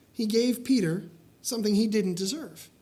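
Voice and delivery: normal talking voice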